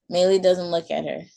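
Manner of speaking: usual speaking voice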